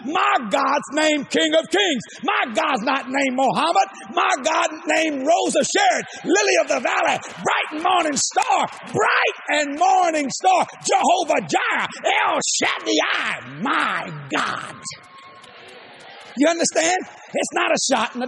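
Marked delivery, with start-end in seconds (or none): none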